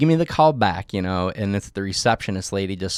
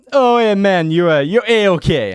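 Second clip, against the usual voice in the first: higher, louder, slow Jamaican nurse impression; mock Jamaican accent; 8 semitones higher, 6 dB louder than usual. Nurse giving good results, mock Jamaican accent. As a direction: drawl it out in a laid-back Jamaican accent. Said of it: "Jamaican accent".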